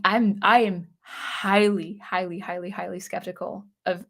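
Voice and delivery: ordinary delivery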